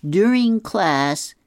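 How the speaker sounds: high, loud, slow stressed rant; stressed angry kid. Retold or imitated. Boy complaining to dad about phone ban, high stressed whine. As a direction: whine it out like a boy outraged by a school rule. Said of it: "He is so stressed about this and really angry".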